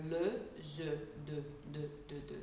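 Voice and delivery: "le, je, de" with French rounded vowels, tongue forward. Shown as a demonstration. Coach showing tongue placement behind the front teeth, "de" in French. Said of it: "if you were to say the French word de".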